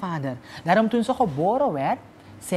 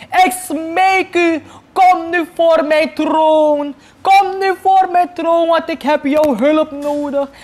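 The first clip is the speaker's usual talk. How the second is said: pleading king's summons; 3 semitones higher than usual. Acted. King calling the sorceress to his throne, sing-song pleading. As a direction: beg the sorceress to appear, drawn out.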